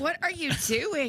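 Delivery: high, slow "what are you doing"; watching a friend go nuts on live video, high incredulity; mock incredulous. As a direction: stretch it out in disbelief. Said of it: "I was like".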